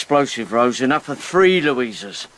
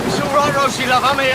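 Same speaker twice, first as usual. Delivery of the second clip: high, raised call over the wind; calling over storm; 12 semitones higher, 3 dB louder than usual. Acted. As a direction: reach her across the storm.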